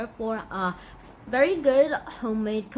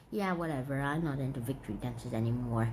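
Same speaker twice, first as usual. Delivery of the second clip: deadpan; 13 semitones lower, 9 dB quieter than usual. Real, not acted. Deadpan retreat.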